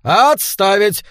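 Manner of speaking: loud barked parade commands; shouting drill sergeant. A narrator's reading. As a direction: bellow orders at recruits. Captain drilling new recruits.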